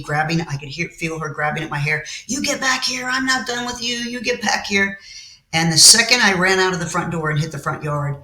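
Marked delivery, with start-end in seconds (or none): 2.3-5.0 high-pitched imitation of an angry mother; chasing mother's demand. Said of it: "my mother was chasing me".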